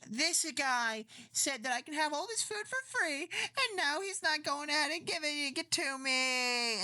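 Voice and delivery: high, whiny fake sobbing plea; mock crying to the cops. Woman whining to the cops, mock crying. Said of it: "she starts crying to the cops".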